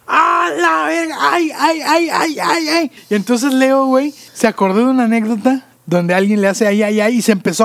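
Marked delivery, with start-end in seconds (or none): 0.1-2.9 high, drawn-out mock cries of pain; retold pain cries. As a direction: relive the knee injury in pained wails. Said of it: "Y le digo a Leo".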